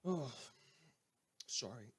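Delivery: a hushed whispered sorry; whispered aside. Acted. Host muttering an apology off-mic, whispered.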